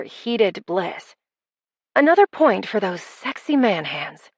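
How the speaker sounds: plain reading voice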